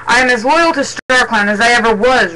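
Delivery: ordinary delivery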